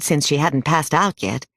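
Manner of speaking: ordinary delivery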